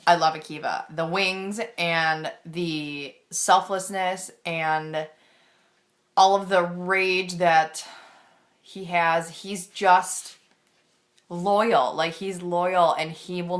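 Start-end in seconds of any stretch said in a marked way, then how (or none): none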